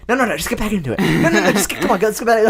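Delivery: high, laughing, insistent urging; insistent brain voice. Acted. Host acts his brain urging him back, high laughing insistence. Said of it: "what my brain's trying to do".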